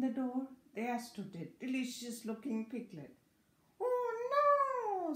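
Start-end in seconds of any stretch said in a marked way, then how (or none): 3.8-5.1 high, loud storybook scream; piglet's high wail of dread; screamed dismay. A narrator's reading. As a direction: cry out high and long in alarm. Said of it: "screamed Piglet".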